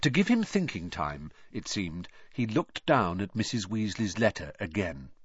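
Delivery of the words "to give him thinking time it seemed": usual speaking voice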